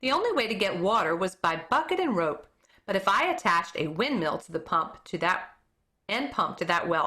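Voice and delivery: plain reading voice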